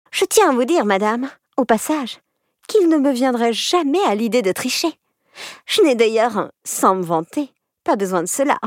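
high, sugary smug schoolgirl; smug honeyed voice. Acted. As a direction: flatter the teacher smugly. Smug pupil flatters teacher.

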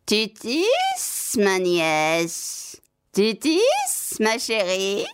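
high lilting witch voice from the yard; Baba Yaga calling. Acted. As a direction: call up sweetly, a crone faking tenderness. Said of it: "Baba Yaga demanda encore une fois".

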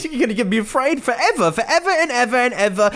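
high, laughing sing-song taunt of fear forever; mocking singsong. Mimicking the TV expert, mock sing-song. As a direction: sing it like a cheerful jingle, laughing. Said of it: "You can hear it in this woman's voice".

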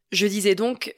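ordinary talk

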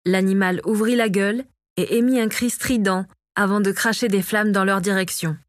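ordinary delivery

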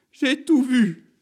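very high, loud, drawn-out declaration; high-voiced ancient crone bearing witness; old witness's cry. A narrator's reading. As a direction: wail it out as a dramatic revelation. Old courgette begins her testimony.

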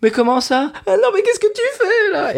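voix très aiguë, outrée, à l'italienne; chef italien outré. Retold or imitated. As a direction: imiter un chef italien scandalisé. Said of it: "C'est-à-dire qu'il dit". (chef italien excédé par une recette ratée, voix perchée)

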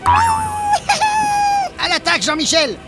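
long drawn-out charge shout; battle cry. Acted. Battle cry calling the ally. (cri de guerre)

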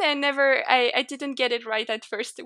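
ordinary delivery

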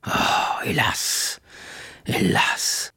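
whispered despairing sigh; murmur. A narrator's reading. Old prisoner realizing his mistake, whispered lament. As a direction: breathe out the regret. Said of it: "murmura la voix".

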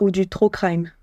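put-on "trop crime" with a French accent, tʀo kʀim. Joking with her co-host, "trop crime" in a French accent. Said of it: "avec l'accent français".

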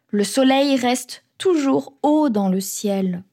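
ordinary delivery, nothing marked

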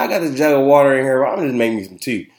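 ordinary delivery

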